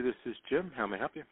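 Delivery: ordinary talk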